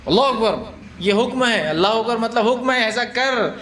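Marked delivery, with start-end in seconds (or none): 0.1-0.6 commanding call. Shown as a demonstration. Teacher mimicking worshippers ordering the imam, commanding. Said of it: "حکم دیتے ہیں".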